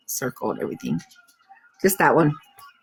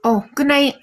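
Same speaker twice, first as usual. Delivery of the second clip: ordinary talk, nothing marked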